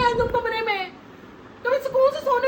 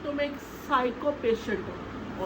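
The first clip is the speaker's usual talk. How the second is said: muttered insult; 12 semitones lower, 8 dB quieter, slower than usual. Acted. Wife mutters insult at husband.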